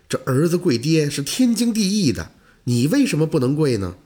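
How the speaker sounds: plain reading voice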